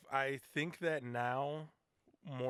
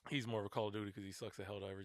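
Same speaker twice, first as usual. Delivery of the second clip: ordinary delivery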